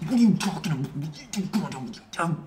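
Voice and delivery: muttered very softly, under the breath; a husband muttering crossly at his wife under his breath. Retold or imitated. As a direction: hiss it so the guests won't hear. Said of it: "arguing with his wife, but under his breath like this".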